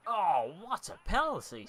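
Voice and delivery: thrilled gamer yelling at a goal; excited cheer. Real, not acted. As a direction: roar it as the penalty goes in. Celebrating a scored penalty in game.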